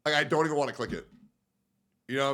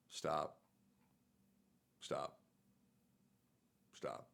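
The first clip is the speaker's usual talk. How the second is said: each stop softer until almost gone; fading pleas; 10 semitones lower, 17 dB quieter, much slower than usual. Pretend. Begging a comment to stop, fading to silence. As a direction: beg it to stop, fading into a whisper.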